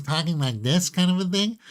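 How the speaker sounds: high, rising head-voice placement; head voice demo. Shown as a demonstration. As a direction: lift the voice up into your head as you talk. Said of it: "within the head voice".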